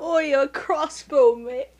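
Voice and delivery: slow, loud British imitation; hammed-up British mimicry; mock British accent. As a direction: draw it out in a bad British accent. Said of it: "a horrible British accent".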